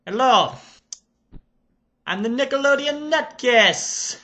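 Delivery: put-on Serbian accent. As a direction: play an excited Serbian reviewer character. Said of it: "I shall get into character".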